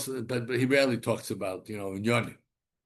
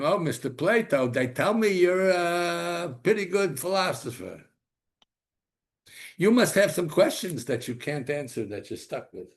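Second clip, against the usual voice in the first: teasing prophet; 8 semitones higher, 6 dB louder than usual. Retold or imitated. Yirmiyahu answers Plato.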